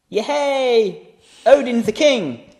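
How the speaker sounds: high, loud triumphant cheer. Acted. Banner proclaims the new king, cheering. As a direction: hail the king, drawn out and loud.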